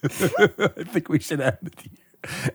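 high, slow words broken up by laughter; laughing real. Real, not acted. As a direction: try to speak while cracking up. GM breaking down at the joke.

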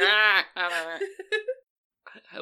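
loud rising camel bray; camel noise. Acted. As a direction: honk like a braying camel. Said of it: "I like making animal noises".

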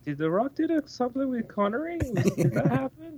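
puzzled, half laughing; confused surprise. Retold or imitated. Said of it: "I was like".

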